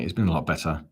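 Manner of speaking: ordinary talk, nothing marked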